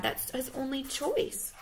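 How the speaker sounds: plain reading voice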